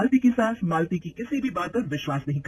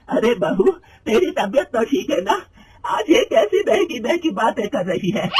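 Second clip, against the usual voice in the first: higher, louder, slow angry scolding; angry mother-in-law; 6 semitones higher, 6 dB louder than usual. Acted. Mother-in-law scolding daughter-in-law about a job, angry and loud. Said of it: "गुस्से में कहती है".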